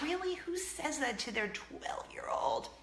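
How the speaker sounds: voiceless stage-whisper exclamation, slow; whispered disbelief. Real, not acted. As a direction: scold yourself in a hushed shout. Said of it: "I'm like".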